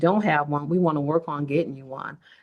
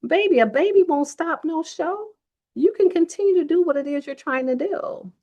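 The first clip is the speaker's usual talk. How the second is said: much higher, firm mom voice; mom's exact words; 12 semitones higher, 4 dB louder than usual. Retold or imitated. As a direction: quote mom the way she really said it. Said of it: "She said it just like that".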